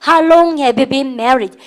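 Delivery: slow classroom repeat-after-me; very slow drill reading. Shown as a demonstration. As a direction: say it slowly so students can follow. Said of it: "시작".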